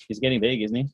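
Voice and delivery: normal talking voice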